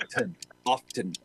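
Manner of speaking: "often" low, broken out as off-TIN; sounded T. Shown as a demonstration. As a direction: isolate the "tin" at the end of "often". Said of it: "Only in a small outpost".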